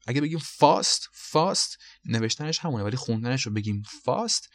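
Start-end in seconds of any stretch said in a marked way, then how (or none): 0.4-1.8 fast with a long British 'ah', as in father, pitched up; British pronunciation. Shown as a demonstration. 3.8-4.5 British fast with a long 'ah'; British pronunciation. Shown as a demonstration.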